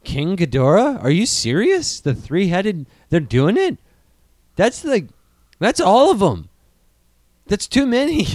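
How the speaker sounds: high, loud shocked excitement; can't believe the news; real excited disbelief. Real, not acted. Host reads casting news and reacts, high astonished.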